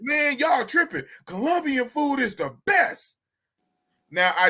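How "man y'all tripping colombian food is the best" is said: slow, high mock shout of protest; mock indignant shout. Host imagining a guest praising Colombian food, slow mock high shout.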